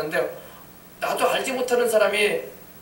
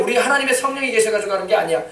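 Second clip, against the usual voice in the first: ordinary delivery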